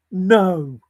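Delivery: normal talking voice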